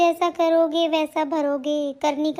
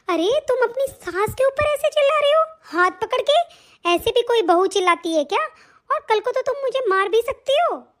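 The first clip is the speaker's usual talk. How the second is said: higher, pleading cry of a hurt mother-in-law; alarmed protest; 6 semitones higher than usual. Acted. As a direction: beg her to let go of the hand. Mother-in-law protests, hand grabbed.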